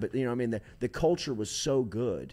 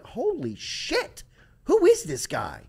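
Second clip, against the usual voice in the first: slow, much higher stunned gasp of disbelief; awestruck; 3 dB louder, slower than usual. Retold or imitated. Fans stunned by the new coach, high awestruck disbelief. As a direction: let the amazement spill out. Awed reaction of listeners.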